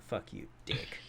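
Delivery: very soft, low mutter; hushed, retold insult; spiteful aside. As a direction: curse him under the breath. Acts his spiteful aside to customer.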